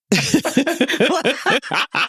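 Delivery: high, sudden burst of laughter; laugh coming in hot. Real, not acted. Co-host laughs straight into a hot mic, high burst of laughter. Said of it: "[name]'s coming in hot".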